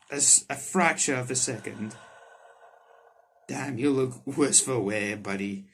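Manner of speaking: normal talking voice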